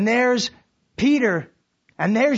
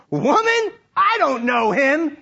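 defensive denial; 6 semitones higher, 4 dB louder, slower than usual. Acted. Peter denying to servant girl.

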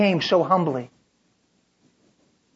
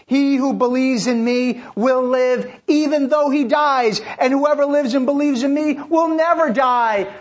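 higher, louder and slow proclamation; full-force preaching; 9 semitones higher, 5 dB louder than usual. Acted. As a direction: preach it out with conviction.

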